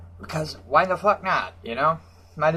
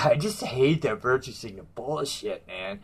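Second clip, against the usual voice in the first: ordinary delivery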